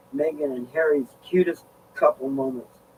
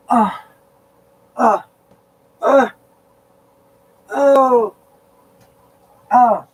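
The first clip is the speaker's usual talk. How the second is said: loud rising groans of real back pain; a run of loud, hurting ahs; 9 semitones higher, 10 dB louder, much slower than usual. Real, not acted. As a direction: groan out each breath through the ache.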